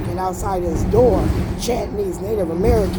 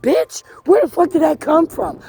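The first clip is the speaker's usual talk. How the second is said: high, sharp disbelieving jab; hammed-up furious disbelief; indignant retort; 10 semitones higher, 3 dB louder than usual. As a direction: fire it back, outraged. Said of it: "it's like".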